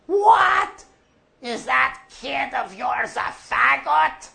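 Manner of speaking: a very high, loud incredulous yell; old man's outraged cry; shocked shout. Retold or imitated. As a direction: shout it out in disbelief.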